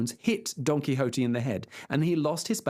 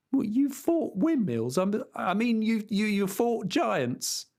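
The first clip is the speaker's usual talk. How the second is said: higher, stammering squire; flustered farmhand; 8 semitones higher, slower than usual. Acted. Squire baffled by his master's claims, higher stammer. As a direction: stumble over the words, pitched up. Sancho's voice.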